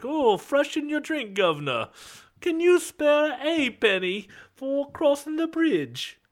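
very high, drawn-out Cockney beggar; Cockney pauper accent. Acted. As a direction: beg slowly in a squeaky-high Cockney pauper voice. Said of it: "that was my Cockney pauper accent".

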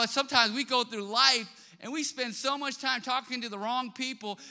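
rising sermon fervor; full-force preaching. Acted. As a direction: drive it home to the congregation.

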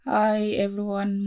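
ordinary delivery

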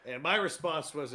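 ordinary talk

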